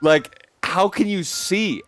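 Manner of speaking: high, slow baffled "Grandma, what are you doing?"; stretched-out disbelief at grandma; asking grandma. Retold or imitated. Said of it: "I'm like".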